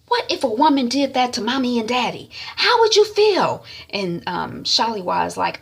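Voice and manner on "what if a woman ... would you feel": indignant challenge. Retold or imitated. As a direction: challenge her conscience. Sister scolds sister.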